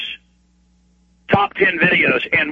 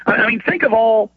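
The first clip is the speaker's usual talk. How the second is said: ordinary delivery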